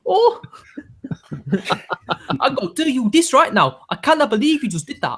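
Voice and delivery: high-pitched, hammed-up Geordie indignation; Geordie accent. Co-host mimics an outraged Newcastle local, high Geordie accent. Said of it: "the language of the Geordie".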